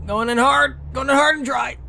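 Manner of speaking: high, loud hyped yell; excited shout. Real, not acted. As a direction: yell it charging in. Charging into roundabout.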